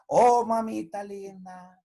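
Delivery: sing-song buttering up; syrupy sweet-talk again. Retold or imitated. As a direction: gush over mamita to get breakfast. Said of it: "Y mañana".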